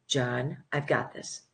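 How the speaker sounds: calm, hushed divine reassurance; quiet voice of God. Retold or imitated. God's voice retold again.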